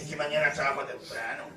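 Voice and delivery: mock excuse. Mocking churchgoers' excuse.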